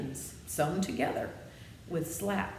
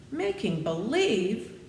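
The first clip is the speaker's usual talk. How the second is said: slow, higher, louder disbelieving echo; astonished cry; 6 semitones higher, 6 dB louder, slower than usual. A narrator's reading. Dorothy repeats the wizard's words in astonished disbelief. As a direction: stretch the words out in shock. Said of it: "cried Dorothy".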